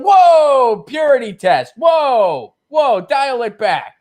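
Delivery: high loud scoffing whoa; mock alarmed whoa. Crowd scolding a purist, high loud mocking whoa. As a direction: shout whoa like a scandalized centrist. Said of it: "It's just like".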